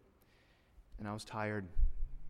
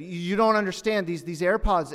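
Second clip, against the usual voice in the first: raised, pleading cry of a desperate father; desperate plea; 6 semitones higher, 6 dB louder than usual. Retold or imitated. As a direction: beg louder, near the breaking point. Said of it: "And finally, he said".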